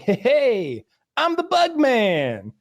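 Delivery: high, slow singsong announcement; mocking bug man. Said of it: "he was like".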